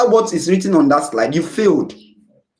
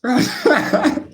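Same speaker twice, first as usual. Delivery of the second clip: a high burst of real laughter; 11 semitones higher than usual. Real, not acted. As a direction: laugh it off, amused. Teacher laughing at own joke.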